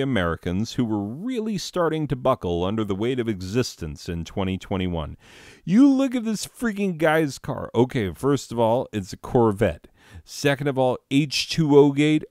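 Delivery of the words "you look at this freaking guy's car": outraged rant. Acted. Ranting about Corvette owner.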